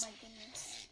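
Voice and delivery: hushed whisper of dismay. Real, not acted. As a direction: say it barely voiced. Reacting to old video clip.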